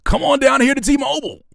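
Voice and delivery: high, loud commercial holler; mock ad pitch. Streamer mocking a phone plan ad, hammed-up pitch. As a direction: sell it like a pushy phone ad.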